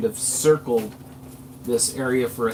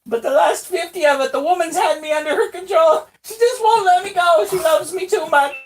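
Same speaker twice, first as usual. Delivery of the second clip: high squeaky shout, louder; 6 dB louder than usual. Acted. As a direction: bemoan it in a loud squeak. Daryl bemoans the woman's control.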